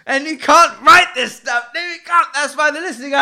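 loud, high, giddy exclamation; excited. Real, not acted. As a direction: gleefully exclaim to the listeners. Excited outburst after laughing.

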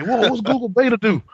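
surprised. Retold or imitated. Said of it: "They say".

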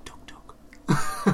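breathy whispered nickname. Acted. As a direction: whisper it like a secret.

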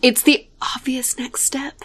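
conspiratorial whisper; breathy secret aside. Real, not acted.

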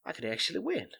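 hushed, disbelieving realisation that rises in pitch; a mock Joe muttering in shock at his own luck; dawning surprise. Imitating Joe, hushed and surprised he could win. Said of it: "he goes".

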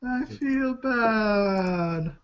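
mock crying. Mock sad.

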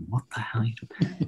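quick, hushed mock disbelief; mock bafflement. French local reacting to his broken French, hushed mock bafflement. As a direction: imitate a baffled local in a rushed half-whisper. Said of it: "And they're like".

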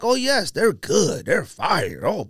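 ordinary delivery

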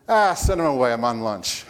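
mock bureaucrat waving off a visitor; dismissive state worker. State worker to secretary about a visitor, dismissive. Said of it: "like a good state worker".

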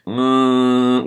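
closed nasal mm hum; the wrong, nasal hum to avoid. Shown as a demonstration. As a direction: hum it through the nose. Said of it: "don't think nose".

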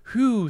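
high, loud "whew"; exclaimed whew from a story hero; relieved whew. A narrator's reading.

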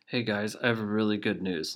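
usual speaking voice

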